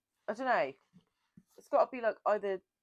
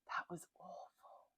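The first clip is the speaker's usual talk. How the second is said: disgusted whisper; 13 dB quieter than usual. Real, not acted.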